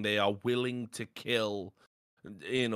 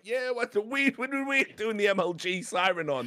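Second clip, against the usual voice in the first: mock hype; 9 semitones higher than usual. His hyped self at the event.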